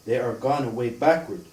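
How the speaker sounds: ordinary talk, nothing marked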